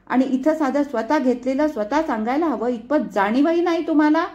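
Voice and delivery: high, loud indignant lecture; mother shouting at daughter. A narrator's reading. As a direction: yell at the careless kids. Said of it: "ती ओरडली".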